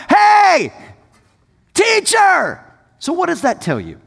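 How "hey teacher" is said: high, loud yell over a crowd. Retold or imitated. Man in the crowd yells to Jesus, loud and high. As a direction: shout to be heard above hundreds. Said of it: "This guy yells out".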